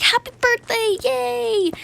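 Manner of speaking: very high, hammed-up congratulations; fake cheer. Player congratulating a suspected gift beggar, high fake cheer. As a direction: cheer it in a squeaky put-on voice.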